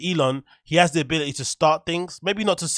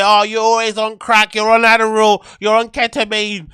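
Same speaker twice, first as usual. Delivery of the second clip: higher, louder mocking voice of the critics; 7 semitones higher, 8 dB louder than usual. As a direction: parody the people who accuse him, loud and high. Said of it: "People say".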